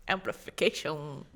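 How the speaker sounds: a hint of a French accent, slow and soft; lightly French-accented, drawn out. Real, not acted. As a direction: say it softly, slightly French. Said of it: "it was just in a French accent".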